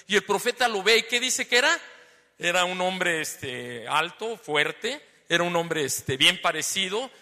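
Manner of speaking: loud, full-voiced preaching; shouting. Real, not acted. Preacher telling the congregation about the prophet, shouting. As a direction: push the voice out to the whole room. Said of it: "Ah, bueno, las dos cosas, estoy gritando".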